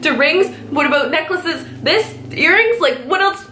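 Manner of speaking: rising, anxious rapid questions; flustered traveler quizzing the guard; panicky questions. Retold or imitated. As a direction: fire off nervous questions at security. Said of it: "Like, freaking out".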